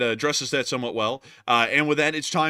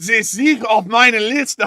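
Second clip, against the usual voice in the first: higher, louder, slow, hammed-up German villain accent; mock German accent; 9 semitones higher, 11 dB louder, slower than usual. Co-host's invitation answered with a loud, slow mock German accent. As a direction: bark it like a cartoon German interrogator, slow. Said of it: "what say you?".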